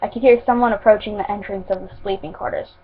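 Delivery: ordinary delivery, nothing marked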